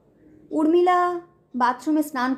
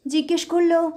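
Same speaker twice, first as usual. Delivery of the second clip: plain reading voice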